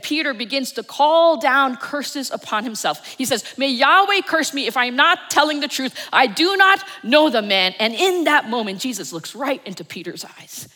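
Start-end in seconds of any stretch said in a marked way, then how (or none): 3.6-7.7 loud, higher desperate cursing oath; swearing oath. Acted. Peter cursing himself before the bystanders, loud and desperate. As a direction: swear it out in panic.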